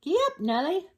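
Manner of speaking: riding skeleton. Acted. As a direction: urge the elephant on cheerfully. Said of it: "So they rode on the elephant".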